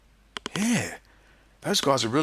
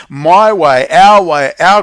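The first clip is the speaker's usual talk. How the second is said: emphatic louder push; 3 semitones higher, 9 dB louder, much slower than usual. Real, not acted. Pitching his group forcefully.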